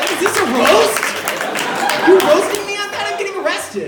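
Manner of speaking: loud, high-pitched comic disbelief; indignant outburst. Acted. Comic protesting being roasted while getting arrested, loud indignation. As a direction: yell it in mock outrage.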